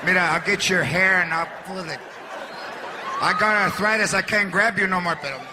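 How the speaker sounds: grandma in denial. Retold or imitated. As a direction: play the old lady swearing she never hit him. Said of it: "she's all in denial".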